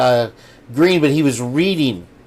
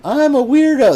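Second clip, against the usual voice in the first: high-pitched quote; 11 semitones higher, 3 dB louder than usual. Retold or imitated. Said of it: "It was like".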